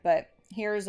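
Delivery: ordinary talk, nothing marked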